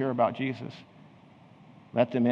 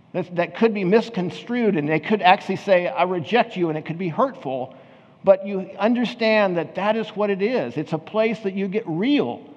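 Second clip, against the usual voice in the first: higher, louder preaching voice, pressing the point home; full-force preaching; 9 semitones higher, 3 dB louder than usual. Acted. Pastor urging the congregation to be authentic, full-force preaching.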